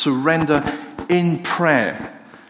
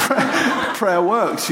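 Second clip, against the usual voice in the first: laughing; 6 semitones higher, much slower than usual. Real, not acted. Laughs at the dog's return.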